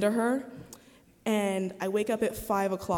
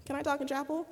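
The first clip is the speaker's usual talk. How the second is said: higher, goofy asking voice; 6 semitones higher, 4 dB quieter, faster than usual. Retold or imitated. Emailing the chaplain, goofy and playful. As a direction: ask it playfully, half joking. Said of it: "being goofy".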